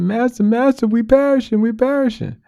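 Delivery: high panicked cry for help. Retold or imitated. Frightened disciples crying out.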